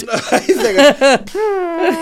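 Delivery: high, very slow words lost in laughing; can't finish for laughing; helpless laughter. Real, not acted. Trying to speak to his friend through laughter.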